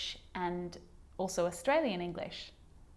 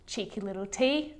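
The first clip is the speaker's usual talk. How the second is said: ordinary talk, nothing marked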